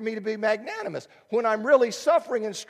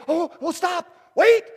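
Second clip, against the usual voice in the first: much higher and louder, hammed-up alarm; mock panic; 9 semitones higher, 9 dB louder than usual. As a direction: yelp it like a scared quitter. Imagined prophet backing out.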